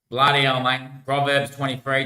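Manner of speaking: ordinary talk, nothing marked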